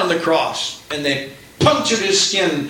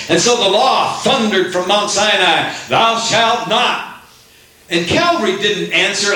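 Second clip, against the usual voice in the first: raised, rhythmic pulpit preaching, rising higher; fiery preaching; 8 semitones higher than usual. Acted. Preacher contrasting Sinai and Calvary to the congregation, fiery preaching. Preacher building to full force.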